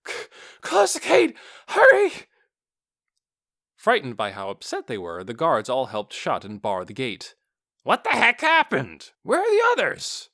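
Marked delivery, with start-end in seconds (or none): 0.1-2.1 high, loud, gasping shout; breathless panicked shout. A narrator's reading. Terrified patrollers yell at the gate guards, breathless shout. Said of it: "shouting with what little breath". 7.8-10.3 high, loud, alarmed question; alarmed demand. A narrator's reading. A gate guard asks the survivors, alarmed shout.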